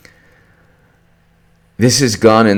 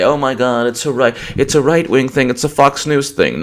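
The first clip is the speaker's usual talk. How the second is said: high-pitched mock complaint; mocking whine; 7 semitones higher than usual. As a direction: imitate whiny critics, pitched well up. Mocks critics' dismissive objection.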